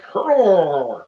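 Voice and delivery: a loud high hammed-up grunt. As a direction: bark out a silly war grunt. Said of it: "where he's like".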